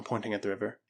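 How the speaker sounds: plain reading voice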